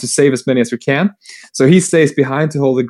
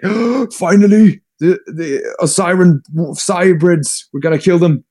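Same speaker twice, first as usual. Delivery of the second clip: mock eager relief; 6 semitones higher than usual. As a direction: burst out as avengers who have waited ages. Retold Ultramarines relishing long-awaited revenge.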